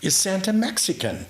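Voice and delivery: put-on high, loud child's voice asking; a small girl blurting it out for the room to hear; little girl's loud question. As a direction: pipe it up like a curious six-year-old. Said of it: "she shakes it again".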